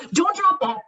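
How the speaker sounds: sudden high-pitched warning; acted urgent warning. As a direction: call out as if something is about to fall. Acting mentor's warning during activity.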